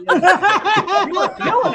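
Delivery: laughing through the words, slow and high; giggly slow tease. Real, not acted. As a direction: tease him while cracking up. Laughing while teasing.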